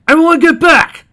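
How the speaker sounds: high, very loud bellow; full-force police shout; roared command. Acted. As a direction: roar at a crowd to clear away. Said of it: "he roared".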